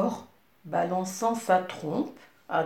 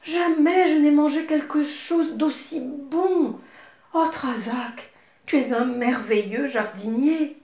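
delighted; 8 semitones higher, 4 dB louder than usual. Acted. Princess praising the gardener.

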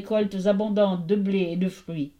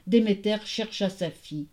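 plain reading voice

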